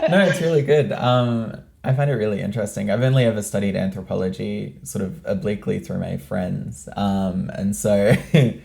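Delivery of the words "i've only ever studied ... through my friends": normal talking voice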